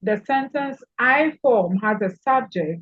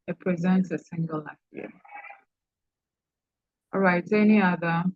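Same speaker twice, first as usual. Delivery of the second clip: normal talking voice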